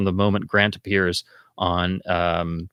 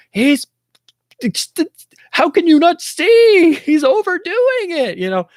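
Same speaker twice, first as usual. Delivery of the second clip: mock screaming at the screen; 7 dB louder than usual. Audience yells at Bond that Grant is overdoing it, high strained mock scream. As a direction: yell at the screen in frustration. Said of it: "you're, like, screaming at that point".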